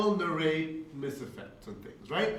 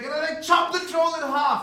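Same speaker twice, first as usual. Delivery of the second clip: much higher and louder, gleeful battle narration; excited player; 13 semitones higher, 9 dB louder than usual. Retold or imitated. New player to the GM, thrilled about her troll attack, much higher and louder. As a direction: burst out with the thrill of the leap. Player describing killing blow.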